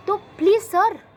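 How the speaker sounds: loud, drawn-out plea, slow; pleading loudly. Pretend. Student begs the teacher to learn pronunciation, loud slow plea.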